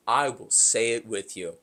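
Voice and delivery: understated stone-cold delivery, retold; blunt, stone cold. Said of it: "very bluntly and stone cold".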